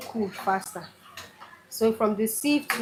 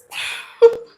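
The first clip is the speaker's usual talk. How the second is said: high breathy burst of laughter; embarrassed giggle; high squeal of laughter; 14 semitones higher, 10 dB louder than usual. Real, not acted. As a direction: laugh it off sharply. Laughing at her accent.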